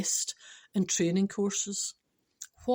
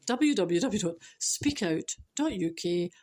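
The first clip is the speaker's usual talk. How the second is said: normal talking voice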